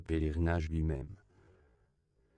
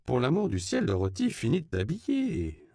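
higher, louder motherly plea; exasperated intervention; 7 semitones higher, 5 dB louder than usual. A narrator's reading. Mother interrupts her daughter's dawdling over dressing, exasperated. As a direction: step in with exasperated emphasis, pitched up. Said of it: "intervint Myriam".

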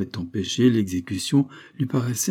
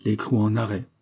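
ordinary delivery

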